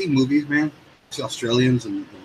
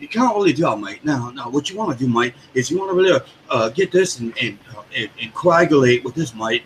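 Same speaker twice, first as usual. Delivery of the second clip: imitated matey English accent. Friend imitating Colt explaining a task, English accent. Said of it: "learned an English accent".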